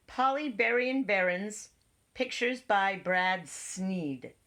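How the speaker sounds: ordinary delivery, nothing marked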